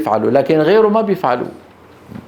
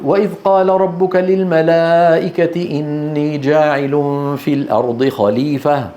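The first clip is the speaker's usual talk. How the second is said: brisk Quran recitation; quick, even vowels throughout; fast tajweed pace; fastest recitation. Shown as a demonstration. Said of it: "هذه أسرع وأسرع".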